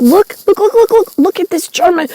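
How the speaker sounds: high, rapid-fire kid imitation; eager child urging. Johannes shows mommy a German knife, eager child voice.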